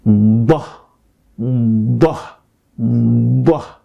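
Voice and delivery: "Bah" with the ḍād, tongue sides pressed, repeated slowly; Arabic ḍād sound. Shown as a demonstration. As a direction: say "Bah" as an emphatic Arabic ḍād, jaw open. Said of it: "So it should sound like this".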